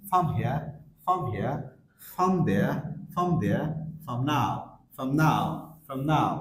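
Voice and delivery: "from" as /fəm/ before the next word; weak form, linked. Shown as a demonstration. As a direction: shorten "from" and lean on the word after it. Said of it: "উইক সাউন্ডে sound আসতেছে ফাম". ("from" "ফাম"-এর মতো ছোট করে)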